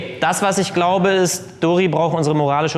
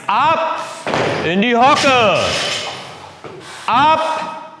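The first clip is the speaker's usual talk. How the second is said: firm squad orders; 8 semitones higher, much slower than usual. Real, not acted. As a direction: bark the lift cues. Coach shouting lift commands.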